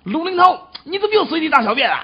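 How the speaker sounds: high, fast scolding; mock yelling. Dog owner acting out scolding his dog, high quick shout. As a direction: tell the dog off loudly and quickly. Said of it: "我就冲他大叫".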